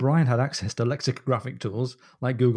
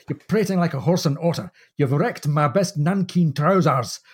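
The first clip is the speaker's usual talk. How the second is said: put-on Scottish accent; 4 semitones higher, 4 dB louder than usual. Master scolds the purser in a Scottish accent. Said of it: "phoneticizes this Scottish accent".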